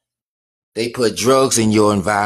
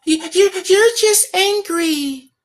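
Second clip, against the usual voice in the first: much higher, slow mock voice; patronizing calm-down voice, pitched way up; slower than usual. Mocking people who tell him he sounds angry, high mock voice. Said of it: "We be talking to people".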